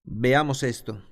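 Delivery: ordinary talk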